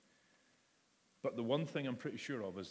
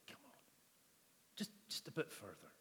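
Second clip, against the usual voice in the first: soft, coaxing call over the fence; hushed encouragement; gentle coaxing; 8 dB quieter, faster than usual. Retold or imitated. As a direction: softly urge him one step further. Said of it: "and says".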